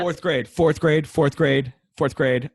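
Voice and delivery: loud, slow, insistent repetition; drawn-out emphatic groan of a reply; emphatic repeated answer. Real, not acted. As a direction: hammer it home, no doubt at all. Asked least favorite grade.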